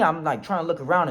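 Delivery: ordinary delivery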